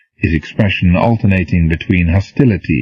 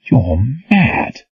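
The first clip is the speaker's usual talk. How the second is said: flat certainty, pitched up; total conviction; 5 semitones higher than usual. A narrator's reading. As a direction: say it like a verdict. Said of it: "Reynolds spoke with total conviction".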